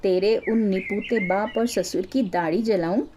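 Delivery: ordinary delivery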